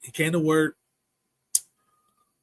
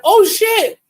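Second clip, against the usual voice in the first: much higher, loud comic shout of oh shit; Leo cursing; 10 dB louder than usual. Retold or imitated. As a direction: yelp it like a friend who sounds funny cursing. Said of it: "he sounds funny when he curses".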